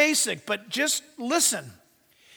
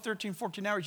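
normal talking voice

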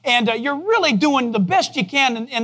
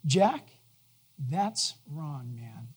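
hushed, low, tender rebuke; Jesus; 8 semitones lower, 11 dB quieter, much slower than usual. Retold or imitated. Jesus gently correcting a friend, no thunder, tenderly hushed. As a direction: let the correction sink very soft and low. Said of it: "the way he says it is".

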